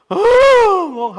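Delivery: loud, high, drawn-out wail; mock wailing. Preacher imitates Mary collapsed at the tomb, loud wailing. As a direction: imitate Mary bawling out loud, stretched long. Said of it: "하고 울었어요".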